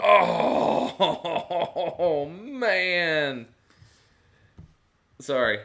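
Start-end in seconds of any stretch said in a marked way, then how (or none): 0.0-3.5 shocked laughter. Real, not acted. Shocked by what just happened.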